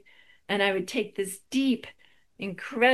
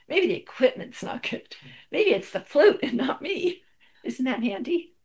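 self-mocking; 7 semitones higher, 5 dB louder than usual. Mocking own excuse.

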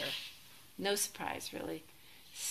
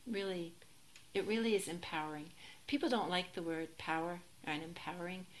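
normal talking voice

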